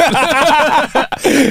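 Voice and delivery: a high laughing squeal; high burst of laughter. Real, not acted. Cohost laughing at the punch line, high squeal.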